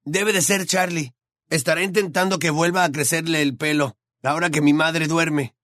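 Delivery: uneasy. A narrator's reading. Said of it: "dijo Ron inquieto".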